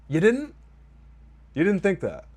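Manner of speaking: high, loud disbelieving "You didn't?"; can't believe what he just heard; real shocked disbelief; incredulous. Real, not acted. Disbelief at character's claim.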